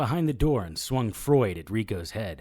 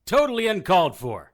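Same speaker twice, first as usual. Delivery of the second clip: indignant complaint; 8 semitones higher, 11 dB louder than usual. Acted. Complaining right after the shocks.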